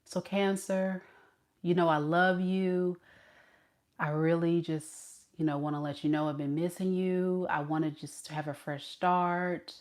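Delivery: soft, low voice pleading with an ex; acted tender plea. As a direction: play a hushed, hesitant apology to a lost love. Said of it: "This person wants to say".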